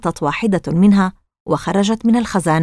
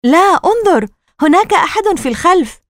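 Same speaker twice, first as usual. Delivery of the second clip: high, louder, urgent insistence; alarmed exclamation; 10 semitones higher, 4 dB louder than usual. Acted. Margaret spotting someone behind the plants, alarmed exclamation. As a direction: insist urgently, pitch leaping up.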